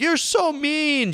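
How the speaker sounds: high, slow, whiny put-on pout; mocking whiny girl. Mocking the woman who scolded him, in a high slow whine. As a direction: drag it out in a sulky high voice. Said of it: "the one chick's like".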